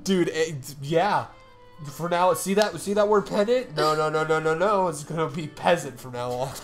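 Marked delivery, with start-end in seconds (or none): none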